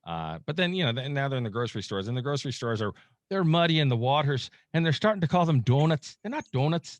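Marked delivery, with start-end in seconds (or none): 3.3-7.0 slipping lightly into an Irish lilt; a hint of Irish accent, pitched up; accidental Irish accent. Real, not acted. Explaining how stores mislabel Fosnauts, in a slight accidental Irish accent. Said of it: "I don't know why I'm going in the Irish accent".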